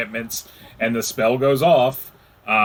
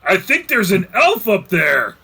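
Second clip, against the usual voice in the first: high, raised orc voice; 6 semitones higher, 4 dB louder than usual. Acted. Orc running up the stairs to his fellows, high raised voice. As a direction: call out as a suspicious orc. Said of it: "Orcish them say something like".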